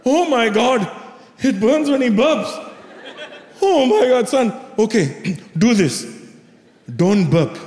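put-on theatrical Indian-accented dismay, settling into advice; Indian mom. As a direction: imitate a dramatic Indian mom. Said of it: "My mom goes".

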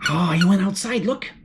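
usual speaking voice

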